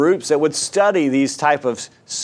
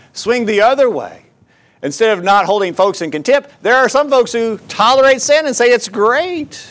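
higher, louder pulpit push; preaching at full force; 8 semitones higher, 5 dB louder than usual. Acted. Preacher warning the congregation against tolerating sin, fervent. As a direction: drive the warning home from the pulpit.

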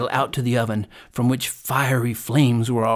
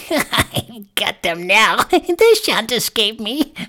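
high, slow, gloating witch cackle; malicious jeering; 9 semitones higher, 5 dB louder, much slower than usual. A narrator's reading. Witch has caught Hansel and Gretel, jeering maliciously. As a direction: laugh wickedly, then jeer in a high crone voice. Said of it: "she laughed maliciously and said jeeringly".

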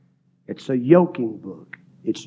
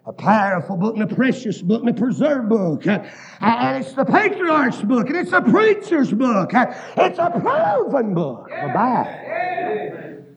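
full-force preaching; 11 semitones higher than usual. Acted. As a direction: preach it out, climbing higher each line. Preaching, climbing to a shout.

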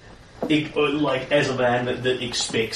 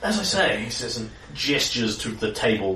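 ordinary talk, nothing marked

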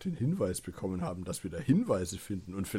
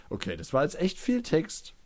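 usual speaking voice

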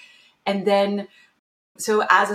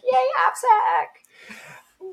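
mock cheer; 5 dB louder, much slower than usual. Cheering her career choice.

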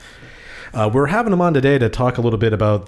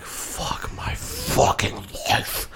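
muttered whisper; 6 dB quieter, much slower than usual. Retold or imitated. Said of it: "And you were like".

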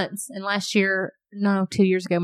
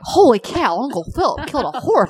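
put-on higher, louder toddler voice with a lisp; lisping little girl; 5 semitones higher, 5 dB louder than usual. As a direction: squeal it like the little niece. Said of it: "I'm like".

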